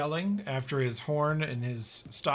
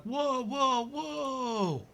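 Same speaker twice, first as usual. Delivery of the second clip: alarmed; 12 semitones higher, much slower than usual. Acted. Bryans react in alarm.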